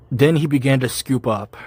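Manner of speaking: ordinary talk, nothing marked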